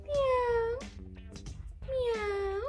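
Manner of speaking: high playful meow; cat meow imitation. Shown as a demonstration. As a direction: meow like a kitten. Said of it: "It goes".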